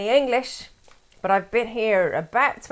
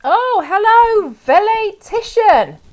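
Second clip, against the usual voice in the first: loud, high, drawn-out hello; effusive greeting; 10 semitones higher, 9 dB louder, slower than usual. Real, not acted. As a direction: greet a newcomer with a big slow flourish. Greeting someone joining the chat.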